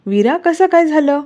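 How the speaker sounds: high-pitched, worried question; alarmed. Acted. Pihu asks Veera about her burnt hand, alarmed. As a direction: ask in anxious alarm. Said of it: "पिहू घाबरून तिच्या हातावर नजर टाकत बोलते".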